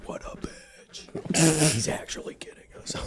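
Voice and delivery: slow hushed whisper. Real, not acted. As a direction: whisper it behind his back. Whispered aside.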